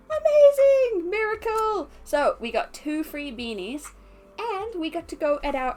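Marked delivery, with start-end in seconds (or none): 0.1-1.9 excited cry. Retold or imitated. Retelling a rebooked ride.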